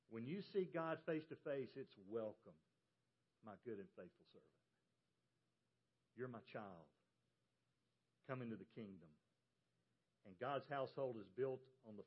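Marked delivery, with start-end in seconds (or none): 2.1-9.1 low, soft voice of God; hushed and welcoming, with pauses between phrases; tender welcome. Acted. God welcoming a believer face to face, low and tender.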